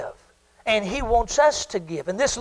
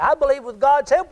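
usual speaking voice

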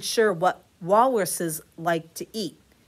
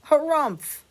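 grumpy harumph; 8 semitones higher, 6 dB louder than usual. Acted. Walrus answering.

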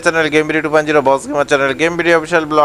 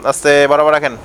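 a lightly voiced bus helper, a touch lower, chanting directions; bus helper calling; 5 semitones lower than usual. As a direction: guide the bus in like a helper on the footboard.

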